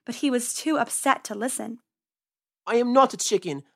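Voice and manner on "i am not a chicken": yelling. Acted. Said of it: "he yelled".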